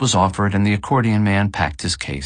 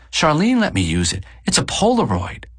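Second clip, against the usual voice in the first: higher-pitched wife explaining; lifted female character voice; wife's voice; 8 semitones higher than usual. A narrator's reading. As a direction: voice the wife well above the narration. Wife's line voiced in narration.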